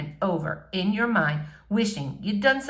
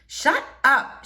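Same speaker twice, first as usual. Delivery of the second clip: shut up; 6 semitones higher, 3 dB louder than usual. A narrator's reading. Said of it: "she fires back".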